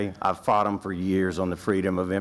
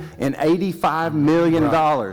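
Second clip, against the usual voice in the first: much higher, louder, talking over someone; raised voice over interruption; 7 semitones higher, 5 dB louder than usual. Real, not acted. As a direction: cut through the interruption. Talks over director's interruptions.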